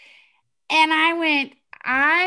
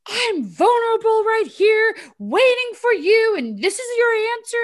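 high, loud hurt protest; re-enacted wounded outburst; retold yelling; 9 semitones higher, 6 dB louder than usual. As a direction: plead indignantly with pitch held high. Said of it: "I'm yelling at his belly button".

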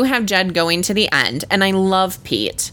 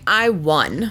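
smug, self-satisfied gloat; 2 semitones lower than usual. Retold or imitated. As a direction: say it like you just beat everyone. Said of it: "that smug look on Luke's".

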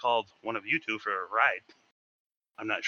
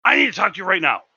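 stern boss; 7 semitones higher, 8 dB louder, faster than usual. Retold or imitated. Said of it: "and he's like".

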